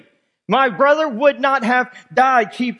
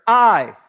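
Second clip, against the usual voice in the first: the word "I" said alone, punched louder; emphatic I; 4 dB louder than usual. Shown as a demonstration. Preacher to church, stressing "I" firmly. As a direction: land hard on "I" as a claim. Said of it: "that's the emphatic".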